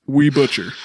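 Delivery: slow, proud slogan read. Pretend. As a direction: announce it like a shop motto. Shop slogan proclaimed.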